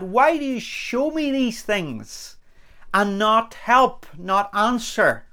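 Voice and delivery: high, slow, bewildered plea; acted prophet's complaint; pleading protest. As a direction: draw out the question to God, imploring. Voices the prophet's dilemma to God.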